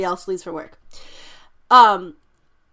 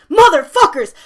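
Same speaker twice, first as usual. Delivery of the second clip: loud, higher burst of swearing; angry shout; 5 semitones higher, 9 dB louder than usual. Retold or imitated. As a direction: yell it at your friends. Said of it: "Moves his hands down".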